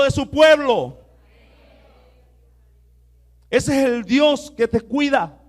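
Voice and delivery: loud, rising sermon to the congregation; loud, high preacher rallying the church; fiery preaching. Acted. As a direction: preach it, full force. Preaching at full force.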